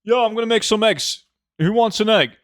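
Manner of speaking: playful pretend cook calling out; mock cheerful offer. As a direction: act like it's totally normal breakfast. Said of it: "and you're like".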